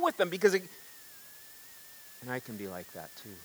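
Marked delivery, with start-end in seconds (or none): 2.3-3.3 quiet admission. Real, not acted. Quiet confession aside.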